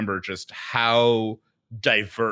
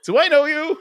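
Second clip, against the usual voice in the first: mock surprised recognition; 6 dB louder, slower than usual. Strangers recognizing his voice.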